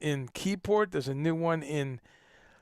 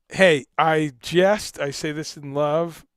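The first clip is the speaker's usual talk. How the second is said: usual speaking voice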